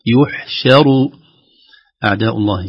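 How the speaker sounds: slow, drawn-out Quranic chanting; tajweed recitation. Shown as a demonstration. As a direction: recite it slowly with full madd. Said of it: "فنقول". (ترتيل بطيء ممدود)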